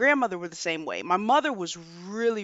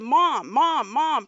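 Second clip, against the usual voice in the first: raised, louder call to get mom's attention; calling out sharply; 3 semitones higher, 5 dB louder, much slower than usual. Retold or imitated. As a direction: call out to a mother who won't respond. Calling drunk mother to snap out.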